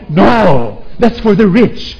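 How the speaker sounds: loud scolding no; scolding mother. Retold or imitated. Mother scolding her son about a toy, loud. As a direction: snap at the child.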